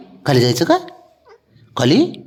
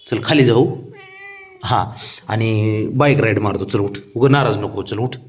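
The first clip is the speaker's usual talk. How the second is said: ordinary delivery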